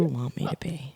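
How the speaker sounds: soft whispered deadpan line; whispered quote. Retold or imitated. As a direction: whisper it flatly.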